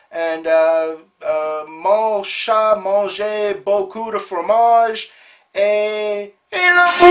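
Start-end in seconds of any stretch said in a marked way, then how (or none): 1.2-7.1 slow, put-on French accent in broken French; mock French. Signing off to Montreal viewers in mock French. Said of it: "bon chance, Montreal".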